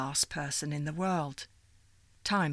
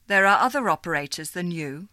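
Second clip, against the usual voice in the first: louder, clipped-off caller reply; curt caller; 8 dB louder, faster than usual. Acted. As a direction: brush her off firmly. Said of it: "the voice said".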